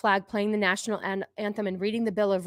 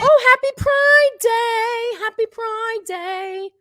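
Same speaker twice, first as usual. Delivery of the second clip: high, slow sing-song parody; mock cheerful greeting; 12 semitones higher, 6 dB louder, much slower than usual. Echoing the video's greeting, high slow mockery. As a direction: stretch it out in a sugary parody.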